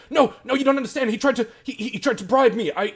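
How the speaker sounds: very high, fast frantic squeal; panicked pleading. Acted. Caught guard frantically denies taking the bribe, panicked high pleading. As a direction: beg your way out in a panic. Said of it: "and he's like".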